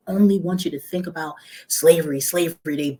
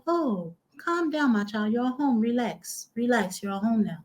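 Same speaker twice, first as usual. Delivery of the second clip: softer, slow, higher tender reassurance; calm a frightened child; soothing elder; 5 semitones higher, 5 dB quieter than usual. Retold or imitated. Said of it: "And they was like".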